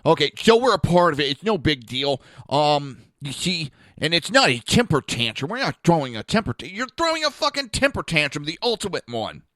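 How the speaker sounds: loud sarcastic mimicry of the woman; mocking imitation. Imitating the woman arguing online, loud mockery. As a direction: parrot her with scorn. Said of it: "kinda like".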